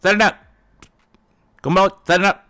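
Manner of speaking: "Sayonara" and "Konbanwa" blurted, loud and high; mock sloppy pronunciation. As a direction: mangle "Sayonara" carelessly. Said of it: "At least he didn't say it like that".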